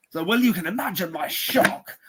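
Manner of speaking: high mock-posh English voice; hammed-up posh English complaint; posh indignant victim. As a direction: imitate an affronted posh gent. Retold posh victim's indignant reply.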